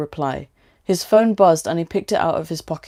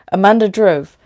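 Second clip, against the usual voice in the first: usual speaking voice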